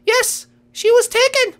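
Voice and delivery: very high, slow rabbit voice; rabbit bearing bad news. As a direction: confirm it slowly in a tiny high voice.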